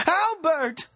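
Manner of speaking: high screamed name, falling at the end; frantic high-pitched cry; desperate calling out. A narrator's reading. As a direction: call after someone lost in fog. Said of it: "She called out after him again".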